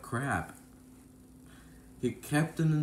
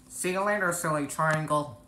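taunting bandit; 4 semitones higher than usual. Acted.

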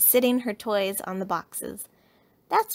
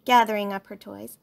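usual speaking voice

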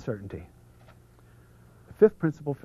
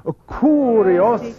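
big, high, loud, drawn-out Italian; best Italian accent; 9 semitones higher, 7 dB louder, slower than usual. Shown as a demonstration. Speaker leading a crowd in unison, exaggerated Italian accent. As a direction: belt it out syllable by syllable like an Italian. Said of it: "with your best Italian accent".

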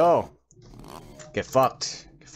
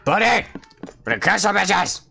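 battle cry; 9 semitones higher, 4 dB louder than usual. Real, not acted. Battle cry charging enemies.

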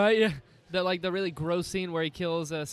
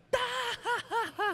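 high-pitched mock cackle; old lady's uproarious cackle, imitated; imitated hysterical laughter. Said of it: "thought it was the funniest thing".

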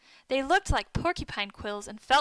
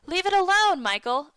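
higher, louder command at the start; firm order; 7 semitones higher, 6 dB louder than usual. A narrator's reading. Boy ordering Michael away from his discovery, firm raised command. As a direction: order him off firmly. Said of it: "I ordered".

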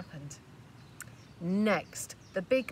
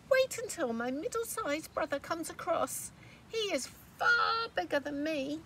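pleading little goat; 9 semitones higher, 4 dB louder than usual. Acted.

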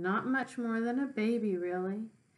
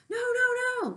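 very high, loud goose protest; a goose warning the pig off; goose crying no; 6 dB louder than usual. Acted. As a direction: shriek it like an alarmed goose. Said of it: "said the goose".

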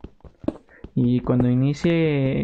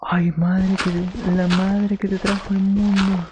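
shocked exclamation; 5 semitones higher than usual. Real, not acted. Reacting to in-game scare.

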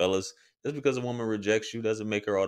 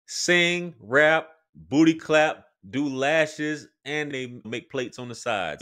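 louder and higher, listing it off; loud mocking rant; 5 semitones higher, 5 dB louder, slower than usual. Real, not acted. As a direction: rant it out at full volume. Mocking rant about Atlanta women.

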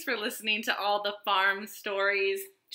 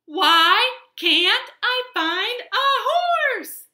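loud, high wail of frustration; frustrated shout; 10 dB louder, much slower than usual. A narrator's reading. Cowboy who cannot find a horse, frustrated shout. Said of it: "he shouted".

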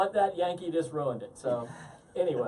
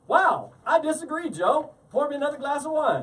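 lightly voiced guest, a bit higher; cheery mock guest calling for more wine; playful guest voice, slightly raised; imagined guest; 7 semitones higher, 6 dB louder than usual. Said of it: "and at the end of that go".

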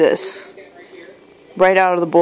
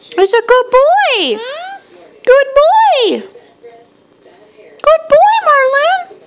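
put-on much higher, slightly louder good-boy coo, drawn out; pet praise baby talk; 4 dB louder, slower than usual. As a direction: gush over the bird in a high sing-song. Praising bird at breakfast.

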